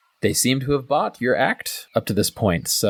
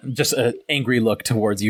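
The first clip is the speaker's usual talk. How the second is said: ordinary talk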